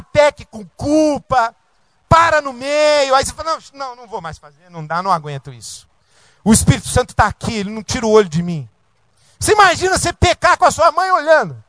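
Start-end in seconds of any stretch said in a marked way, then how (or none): 3.5-8.7 a believer's quick anxious inner voice; rushed inner voice. Retold or imitated. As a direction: gabble it under your breath. Imagined sinner's anxious inner voice.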